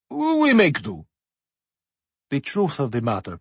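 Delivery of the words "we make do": modest repeat, pitched up; higher humble echo. A narrator's reading. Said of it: "humbly bowed his head".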